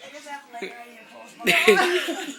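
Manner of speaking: laughing while talking, half shouting; words broken up by laughter. Real, not acted. Laughing about too much oil.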